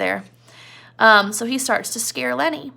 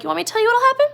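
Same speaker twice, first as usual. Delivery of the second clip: high, loud taunt; taunting Crooks; 13 semitones higher, 4 dB louder, much faster than usual. Retold or imitated. Crooks threatening Lenny with the asylum, high taunt. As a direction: lean in and taunt, higher. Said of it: "Crooks is like".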